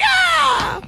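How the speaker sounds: very high squeal of delight; imitating a kid's happy shriek; kids' excited scream. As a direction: squeal like a thrilled kid. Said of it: "and then they're like".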